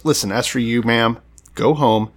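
normal talking voice

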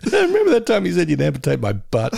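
put-on a light whiny kid voice; Milhouse voice. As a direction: kid voice, jumping high on Remember. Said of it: "Milhouse is gonna be saying".

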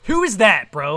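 high, loud interjection; mock incredulous shout. Cutting in on co-host about a reporter, mock outrage. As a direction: burst in with loud disbelief.

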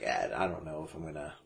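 low, quick, hesitant mumble; quiet. Retold or imitated. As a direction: trail off under your breath.

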